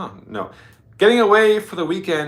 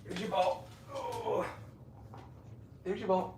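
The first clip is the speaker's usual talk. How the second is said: pet voice; 8 semitones higher, 14 dB quieter than usual. Real, not acted. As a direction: coo at the dog. Talking to dog.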